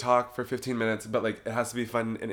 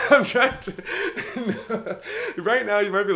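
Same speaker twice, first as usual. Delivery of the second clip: laughing; 9 semitones higher, 4 dB louder, slower than usual. Real, not acted. Laughing mid-explanation.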